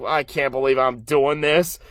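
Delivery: low, mildly deflated quote; a touch of grumbling reluctance; muted disbelief. Retold or imitated. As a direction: say it low, lightly acting resigned. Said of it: "but he's like".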